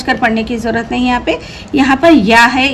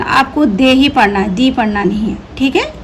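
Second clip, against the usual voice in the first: ordinary talk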